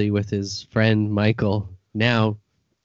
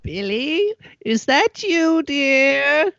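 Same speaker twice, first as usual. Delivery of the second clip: put-on high old grandma voice; 14 semitones higher, slower than usual. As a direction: call out like a sweet old granny. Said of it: "Yes, Grandma".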